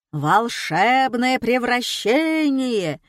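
very slow, loud, drawn-out showman cry; straining yell. A narrator's reading. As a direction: stretch out the call to the audience. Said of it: "надрывался иллюзионист".

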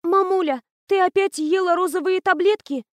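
caring voice. A narrator's reading. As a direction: fake worry for mom. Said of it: "с заботой в голосе".

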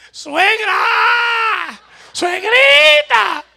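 loud, very high, drawn-out shout; yelling for mother-in-law. Acted. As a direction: bellow across the house for her. Old man yells for mother-in-law. (grito largo llamando a la suegra)

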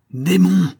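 captain seizing the major by the throat, furious; angry cry. Acted. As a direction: hurl the word at him. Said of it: "s'écria le capitaine".

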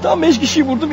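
ordinary talk, nothing marked

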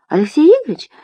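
very high questioning echo; feigned surprise. A narrator's reading. Nadya covering for the academician, feigned surprise. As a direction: ask it high, as if innocent.

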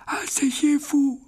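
put-on high, slow frail old wizard; old headmaster voice. A narrator's reading. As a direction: greet him like a frail elderly headmaster. (voix aiguë et lente de vieux directeur)

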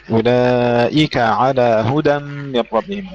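هدى with a clear dal, not dhal; slow, careful model of هدى; slow model recitation. Shown as a demonstration. Teacher models the verse for Jamila, slow and clear. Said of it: "هدى وليس هدى".

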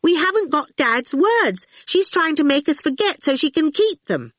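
put-on high-pitched, insistent child's protest; insisting loudly. A narrator's reading. Awful accuses Dillion of keeping Dad's words, loudly insistent. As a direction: press the accusation, high and urgent. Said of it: "Awful insisted loudly".

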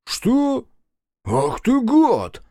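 high, drawn-out angry cry; indignant outburst. A narrator's reading. Policeman insulted as a clown, indignant and about to strike. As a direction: spit the insult out slowly, up high.

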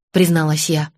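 ordinary delivery

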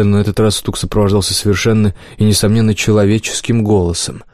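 plain reading voice

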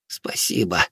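soft, heavy-breathed thanks; hushed, spent murmur; breathless whisper. A narrator's reading. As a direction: whisper it while short of breath. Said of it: "тяжело дыша прошептал Алис".

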